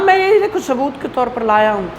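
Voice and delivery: ordinary talk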